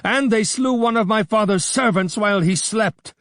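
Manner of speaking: put-on young man backing his brother in wrath; wrathful. A narrator's reading. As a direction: hurl the accusation back. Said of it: "cried Bardilis wrathfully".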